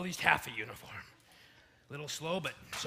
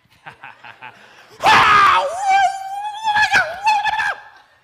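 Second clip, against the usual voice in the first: maniacal laughing shout; 19 dB louder than usual. Acted. Triumphant after smiting.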